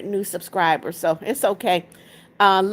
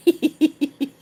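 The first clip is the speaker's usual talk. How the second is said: light amused laugh; 5 semitones higher than usual. Real, not acted. Amused by viewer's greeting.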